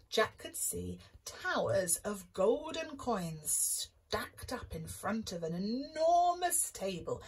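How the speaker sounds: plain reading voice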